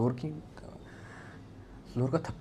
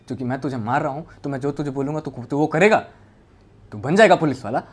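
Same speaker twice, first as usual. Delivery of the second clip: loud, raised, domineering demand; bullying order; 3 semitones higher, 9 dB louder than usual. Retold or imitated. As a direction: build to a loud command as if forcing a child. Retold bullying threat, beating the boy.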